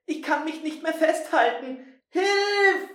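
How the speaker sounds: high, loud panicked shout; panicked cry for help. Acted. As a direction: yell for help while slipping. Slipping down the ladder.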